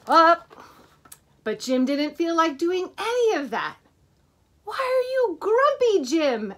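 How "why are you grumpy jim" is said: high, lightly voiced worried friends; friends asking. A narrator's reading. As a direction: coax Jim gently, higher. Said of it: "asked the others".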